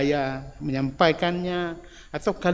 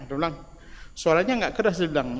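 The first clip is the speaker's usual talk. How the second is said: normal talking voice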